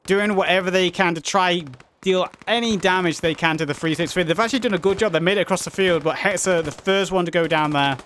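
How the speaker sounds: energetic match commentary; live play-by-play. Real, not acted. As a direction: call the action as it happens.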